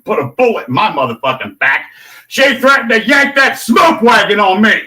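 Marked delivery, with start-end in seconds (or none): 2.3-4.8 raised loud accusing yell; angry shout. Real, not acted. Accusing the female cop, raised angry shout. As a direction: spit it out indignant.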